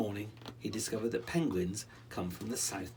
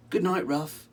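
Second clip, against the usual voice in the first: higher, louder call up a pipe; calling good night; 6 semitones higher, 7 dB louder than usual. Acted. Rollo calling good night up the drainpipe. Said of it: "Rollo's voice echoing up the drain pipe".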